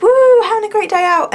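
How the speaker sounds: high, loud whoop of joy; excited cheer. Retold or imitated. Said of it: "I would be like".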